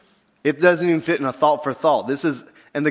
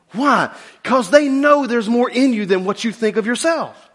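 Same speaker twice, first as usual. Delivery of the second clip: ordinary delivery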